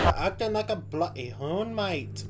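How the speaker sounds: slow, drawn-out attempt at Australian; botched Australian accent. Shown as a demonstration. Reactor copies the comedian's line, badly botched Australian accent. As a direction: have a rough go at an Aussie accent. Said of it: "that was fucked".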